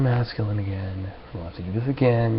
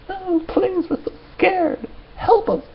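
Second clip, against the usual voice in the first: very high squeaky mock panic; mocking scared police; 5 dB louder, slower than usual. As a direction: squeal like terrified cops. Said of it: "This is the police, right?".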